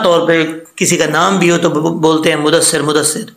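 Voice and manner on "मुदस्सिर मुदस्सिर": मुदस्सिर quick, single short d; common wrong reading. Shown as a demonstration. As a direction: say मुदस्सिर casually without doubling. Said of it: "ये गलत है".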